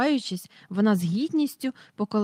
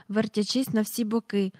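ordinary delivery

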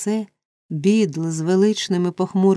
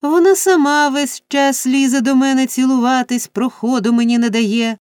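high, loud tattling whine; whining complaint; 6 semitones higher, 4 dB louder than usual. A narrator's reading. Noah blames Charlotte for the kissing, whining loudly. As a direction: shift the blame in a raised whine.

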